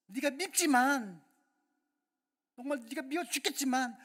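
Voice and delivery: loud, high, frustrated cry; exasperated anger. Acted. God voicing exasperation at his people, loud and anguished. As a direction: spit out how much it hurts. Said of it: "그거 내 본심이 아니야".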